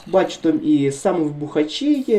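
usual speaking voice

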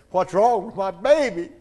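frantic father's cry; desperate shout. Retold or imitated. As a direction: yell it in panic at the doctor. Father demands news of baby.

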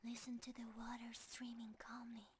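ordinary talk

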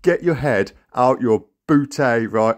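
ordinary talk

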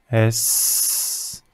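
a soft, voiceless hiss for "S"; letter sound s. Shown as a demonstration. Teacher giving a child the sound of "S" as a soft hiss. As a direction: give "S" as a long unvoiced sss, not the letter name. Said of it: "Je te donne les sons".

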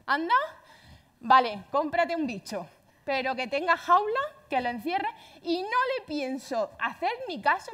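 madre exasperada, más aguda y más fuerte; madre harta. Retold or imitated. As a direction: ceder de mala gana, cargando cada prohibición. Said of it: "Mi madre". (madre harta que cede a regañadientes, voz más alta)

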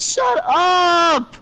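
real frustrated shout; exasperated yell. Real, not acted. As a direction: yell it to cut your friend off. Snapping at friends while muting.

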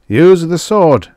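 loud, high, drawn-out command. A narrator's reading. Unseen voice urges the girl to attack, loud and commanding. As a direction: urge it on with force. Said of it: "said the voice".

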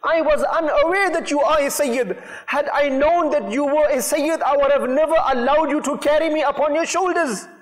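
impassioned plea for forgiveness, pitched high without real sobbing; retold tearful plea. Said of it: "Whilst he was weeping, he's saying".

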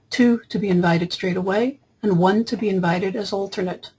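ordinary delivery

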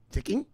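"Ticking" as a questioning guess, pitched high; puzzled misreading. Retold or imitated. A kid puzzling over a Chinese X, "Ticking" as a guess. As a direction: guess "Ticking" with a rising end. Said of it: "How do you pronounce that?".

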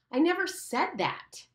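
high, outraged protest; indignant denial. Acted. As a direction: defend yourself hotly. Ralph protests accusation.

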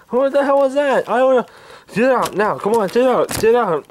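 ordinary delivery

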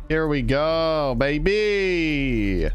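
slow, stretched gleeful call; drawn-out hype. Real, not acted. Hyping himself up as things get going, drawn-out cheer. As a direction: stretch the last word into a cheer.

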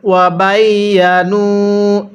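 ওয়া বাইয়ানু with ya doubled, slowly; careful tajweed; slow recitation. Shown as a demonstration. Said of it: "কিভাবে পড়ব?".

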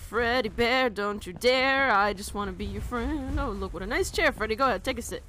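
high-energy alarmed babble; panicked pleading. Real, not acted. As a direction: beg a monster to back off, loudly. Frantically pleading with the monster.

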